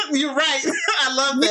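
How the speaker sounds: agreeing through real laughter; laughing. Real, not acted. Host amused by her co-host's emphatic point, laughing. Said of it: "I'm laughing 'cause she stresses something".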